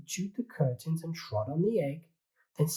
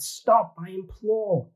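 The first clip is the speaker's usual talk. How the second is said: much higher, louder desperate cry; 7 semitones higher, 9 dB louder, slower than usual. Acted. Old lady crying out at the pig chasing the hen, loud and high. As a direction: shout for it to stop. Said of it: "The little old lady cried".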